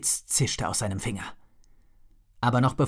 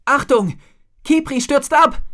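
much higher, much louder alarmed scream; shouting; 12 dB louder than usual. A narrator's reading. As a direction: yell a warning at the sky. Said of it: "schrie Drake".

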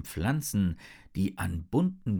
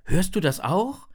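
amazed question; 10 semitones higher, 6 dB louder than usual. A narrator's reading. Amazed boy asks his friend.

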